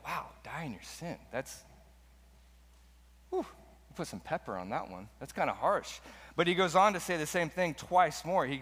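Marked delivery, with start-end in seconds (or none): 0.1-6.0 mock shocked reaction. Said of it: "we're like".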